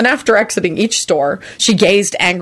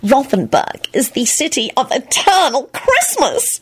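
higher, slow, gushing; gushing excitedly; 7 semitones higher, slower than usual. A narrator's reading. Solange gushing about Rothenburg, higher and slow. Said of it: "she gushed excitedly".

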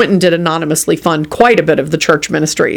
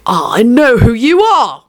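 slow, raised-pitch snarl of accusation; 5 semitones higher, slower than usual. A narrator's reading. William to the suspicious dog, slow accusing snarl. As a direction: snarl it slowly at an exposed enemy. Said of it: "William snarled at her suddenly".

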